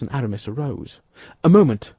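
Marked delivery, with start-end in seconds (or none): 1.4-1.9 loud, commanding halt; sharp cry. A narrator's reading. D'Artagnan halts his friends drawing swords, loud. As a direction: stop them in their tracks. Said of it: "cried D'Artagnan".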